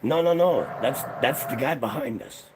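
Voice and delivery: high, loud frantic denial; squealing innocent uncle; panicked denying uncle. Retold or imitated. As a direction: blame the guy behind, fast and loud. Uncle lying to the ranger.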